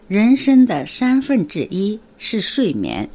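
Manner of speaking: slow, measured Mandarin model sentence; model Mandarin pronunciation. Shown as a demonstration. Teacher models the week's phrase for learners, slow careful Mandarin. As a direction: say 人生的三分之一是睡眠 slowly, each tone fully drawn out. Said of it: "中国語ではどういうふうに言えばいいでしょうか".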